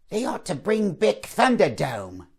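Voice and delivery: a hint of a wombat mother; wombat impression. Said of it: "It's my wombat impression".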